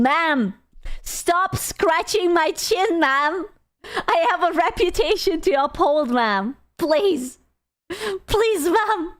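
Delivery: mock pleading, climbing high; indignant mountain lion. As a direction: play a lion begging for its dignity, breaking into laughter. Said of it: "how that poor mountain lion felt".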